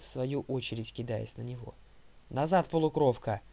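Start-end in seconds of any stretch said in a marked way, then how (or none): 2.3-3.4 loud, commanding warning; ordering. A narrator's reading. Huntress orders the half-blood back, commanding loudly. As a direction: order them back forcefully. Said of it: "приказала девушка с венчиком".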